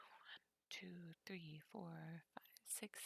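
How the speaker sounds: soft unvoiced count; whispered counting. Real, not acted. Streamer counting cards, whispered under breath.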